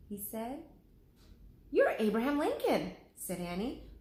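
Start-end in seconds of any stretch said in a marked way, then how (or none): 1.7-3.0 put-on high, eager girl's voice; Annie's voice. Annie tells Lincoln who he is: high eager girl's voice. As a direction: state it brightly.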